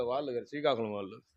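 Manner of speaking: normal talking voice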